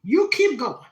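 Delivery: a proud Southern grandma urging her grandbaby on; loud, high-pitched grandma blessing; grandma's voice. Retold or imitated. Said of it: "grandma quote".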